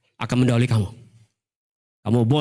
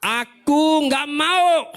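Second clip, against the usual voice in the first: very slow, higher "Aku nggak mau"; stubborn refusal; 11 semitones higher, much slower than usual. Retold or imitated. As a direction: drag out a sulky no. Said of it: "anak itu menjawab".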